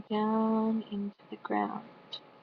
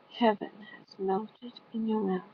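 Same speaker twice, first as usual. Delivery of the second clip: ordinary delivery, nothing marked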